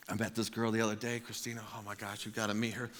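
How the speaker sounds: soft, low aside; friend's hushed gossip. Retold or imitated. Friend in chapel telling about a girl he met, hushed. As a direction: confide it quietly mid-sermon.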